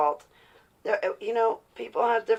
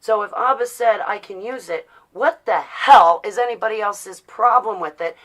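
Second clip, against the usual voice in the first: ordinary talk, nothing marked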